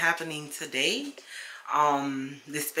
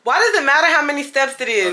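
loud, high-pitched quick comeback; indignant protest; 10 semitones higher, 10 dB louder, faster than usual. Real, not acted. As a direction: fire back defensively, voice jumping up. Teased by partner, protests.

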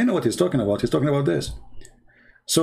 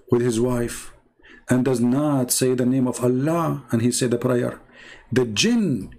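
normal talking voice

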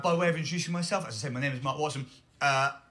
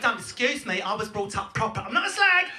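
higher-pitched indignant Essex accent; 4 semitones higher than usual. Shown as a demonstration. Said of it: "It's how we speak".

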